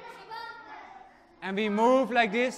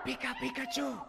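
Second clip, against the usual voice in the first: soft Pikachu squeak; tiny squeaky Pikachu call; 3 semitones higher, 12 dB quieter than usual. Acted. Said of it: "She has a very nice superhero sound".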